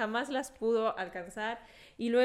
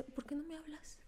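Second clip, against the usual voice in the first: whispered quote; 5 semitones higher, 14 dB quieter than usual. Retold or imitated. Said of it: "y dice".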